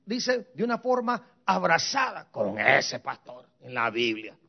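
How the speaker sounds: normal talking voice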